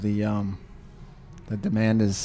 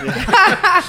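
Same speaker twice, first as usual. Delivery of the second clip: mock whiny squeak; high squeaky mock voice; 6 dB louder than usual. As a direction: jump way up into a squeaky whine. Mocking own whiny voice.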